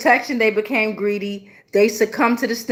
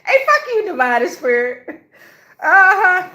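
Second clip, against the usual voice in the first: mock yell at spirit; 9 semitones higher, 4 dB louder than usual. Real, not acted. Yelling at the spirit.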